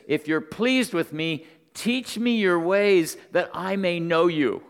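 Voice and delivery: slow, building to a loud, high appeal; acted earnest plea. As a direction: preach Moses' plea, stretching it out with force. Acts out Moses pleading with God.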